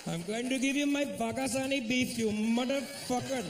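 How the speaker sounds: high-pitched mock Indian accent; domineering lover in exaggerated Indian accent; East Indian accent. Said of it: "is that East Indian accent".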